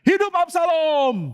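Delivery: teriakan tinggi lantang, pelan; seruan lantang. Acted. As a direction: serukan sambil mengangkat nama raja baru. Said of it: "dia langsung teriak". (Husai berseru lantang kepada Absalom: hidup Absalom)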